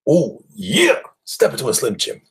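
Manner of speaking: high, loud Oh yeah into the slogan; hammed-up snack ad wrestler; Macho Man ad voice. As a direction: sell the Slim Jim like the wrestler. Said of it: "pocket full of Slim Jims".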